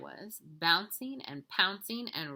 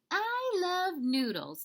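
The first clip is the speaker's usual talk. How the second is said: put-on high, slow little-girl declaration; declaring little sister; 12 semitones higher, 4 dB louder, slower than usual. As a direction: draw it out with childish delight. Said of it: "declared Jilly Boo".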